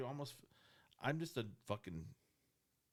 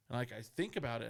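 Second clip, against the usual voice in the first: normal talking voice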